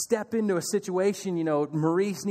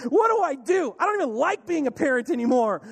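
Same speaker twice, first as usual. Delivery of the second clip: high, loud panicked cry; a stressed mom at her wits' end; frantic mom; 7 semitones higher, 4 dB louder than usual. Retold or imitated. Sermon voicing a frazzled mom, loud and panicked.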